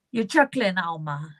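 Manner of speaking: usual speaking voice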